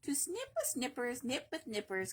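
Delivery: ordinary delivery, nothing marked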